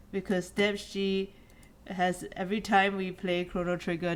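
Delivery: ordinary talk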